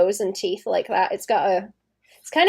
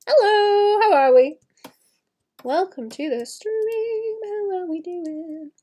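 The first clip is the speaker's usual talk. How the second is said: high slow welcome; welcoming new viewers to the stream; sing-song greeting; 8 semitones higher, slower than usual. Real, not acted. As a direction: greet them brightly and slowly. Greeting chat.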